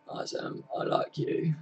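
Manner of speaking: soft unvoiced whisper to Sam; playful whispered greeting. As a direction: breathe it secretly into the mic. Whispering to a viewer on stream.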